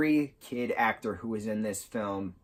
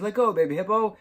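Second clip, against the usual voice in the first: higher, louder quoted line; acted movie quote; 9 semitones higher, 5 dB louder, faster than usual. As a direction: blurt it high like the movie character. Voices a threatened kid in retelling.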